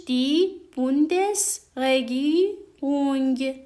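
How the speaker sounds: دی بوندس رگیرونگ slow and clear; slow careful teaching read; slow demo. Shown as a demonstration.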